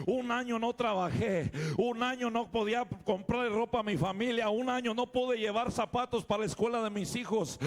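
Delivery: loud, high, urgent preaching; preaching at full force. Acted. Pastor preaching his testimony to the congregation, loud and urgent. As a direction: preach it out to the church, rising.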